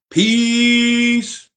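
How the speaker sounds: high squeaky peace; high playful farewell; falsetto peace. Pretend. Falsetto sign-off.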